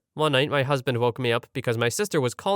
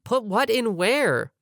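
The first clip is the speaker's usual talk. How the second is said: confused question; 8 semitones higher, slower than usual. Retold or imitated. Confused girlfriend quoted.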